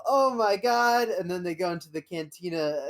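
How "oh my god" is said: slow, high, loud oh my God; drawn-out gasp of wonder; amazed gasp. Retold or imitated. Kids gasping at Mos Eisley, slow and high. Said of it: "And they go".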